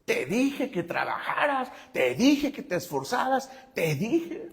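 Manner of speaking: husband recalls wife's hypothetical reproach, mock nagging. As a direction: play the wife who warned him, needling. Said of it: "Miriam pudo haber dicho muchas veces".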